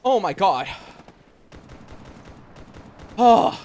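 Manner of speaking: high, tense frightened voice; startled and still frightened; scared. Real, not acted. Hears creature, still scared.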